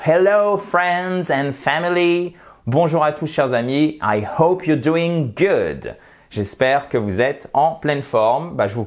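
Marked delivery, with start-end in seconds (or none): 0.0-2.3 drawn-out, higher-pitched English greeting; slow English greeting. Real, not acted. Teacher greeting friends and family, slow and lifted. 4.0-5.9 very slow, drawn-out English for learners; slow English greeting. Real, not acted. Teacher wishing learners well, slow and drawn-out.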